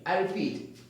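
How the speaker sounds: ordinary talk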